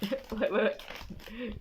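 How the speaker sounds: usual speaking voice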